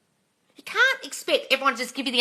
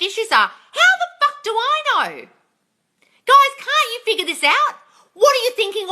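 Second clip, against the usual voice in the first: raised, exasperated rant at the viewers, higher and louder; frustrated yelling; 6 semitones higher, 4 dB louder than usual. Real, not acted. Coach yelling at her live viewers in exasperation. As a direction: let it burst out, fed up with their questions. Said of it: "Sorry to yell at you guys".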